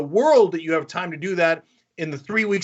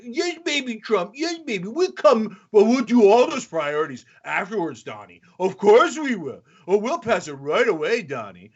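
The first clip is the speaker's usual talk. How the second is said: put-on high cooing baby talk to Trump; mock baby talk; 3 semitones higher than usual. McCarthy and Graham cooing to Trump like a baby. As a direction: coo at him like a toddler. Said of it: "They're like".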